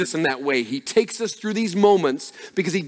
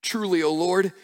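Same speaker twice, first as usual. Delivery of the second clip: raised, pleading prayer voice, lightly acted; earnest prayer; 4 semitones higher than usual. King Hezekiah praying before God over the letter, raised earnest plea. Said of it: "he prayed to the Lord and said".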